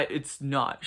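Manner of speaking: ordinary talk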